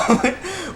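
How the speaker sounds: a sudden high-pitched giggle. Real, not acted. Vlogger giggling at the robot costume reveal, high giggle. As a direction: burst out giggling. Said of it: "I was laughing".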